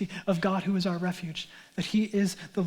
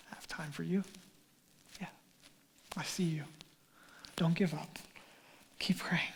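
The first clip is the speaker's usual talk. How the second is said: soft, hushed reassurance; whispered comfort; 4 semitones lower, 12 dB quieter than usual. Acted. God encouraging a weary believer, softly whispered. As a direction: murmur it gently to someone ready to quit.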